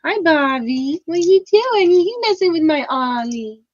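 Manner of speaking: pet talk. Real, not acted. Cooing at her pets.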